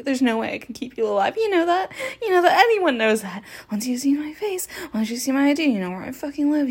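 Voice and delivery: loud manic laughing rant; loud, unhinged, laughing through it; manic shouting. Acted. Killer loses control, laughing.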